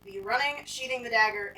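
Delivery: ordinary talk, nothing marked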